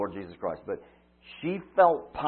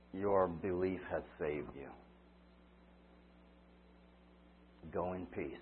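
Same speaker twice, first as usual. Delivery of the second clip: soft, lower, tender words to a daughter; 5 semitones lower, 15 dB quieter than usual. Acted. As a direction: gently reassure her. Jesus speaks to kneeling woman.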